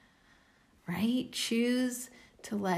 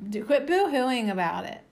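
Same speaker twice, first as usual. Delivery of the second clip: quit boo-hooing, louder and through laughter; giggly, louder retake; laughing; 4 semitones higher, 5 dB louder than usual. Shown as a demonstration. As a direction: crack yourself up saying boo-hooing. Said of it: "I wanna keep on saying boo-hoo".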